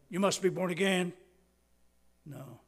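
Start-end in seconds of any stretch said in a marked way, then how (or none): 0.1-1.1 booming, commanding "You must be born again"; loud demand. Shown as a demonstration. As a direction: thunder the words as a stern order. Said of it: "I don't think he was saying".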